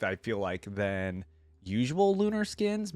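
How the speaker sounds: normal talking voice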